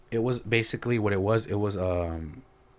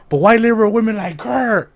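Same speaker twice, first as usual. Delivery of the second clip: high, loud mock accusation; imitated ranting shout; 12 semitones higher, 11 dB louder than usual. Mother pointing out a girl in back, mock shout. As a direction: holler it while singling someone out. Retold mother singling out heckler.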